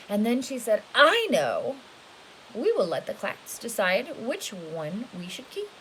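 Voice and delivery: lively storybook read, rising and falling; storybook voice for a little one; reading to children. A narrator's reading. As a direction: read it big and animated for a child.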